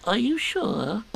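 high, worried small voice; anxious Piglet. Acted. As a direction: ask nervously like a tiny piglet. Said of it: "asked Piglet anxiously".

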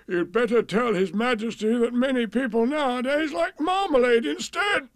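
plain reading voice

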